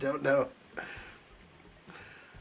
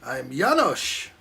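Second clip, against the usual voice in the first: put-on slow, accented villain-sidekick voice; slow, foreign-accented mock introduction; Janosz impression; 5 dB louder, slower than usual. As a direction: drawl it out like Janosz from Ghostbusters 2. Ghostbusters character line.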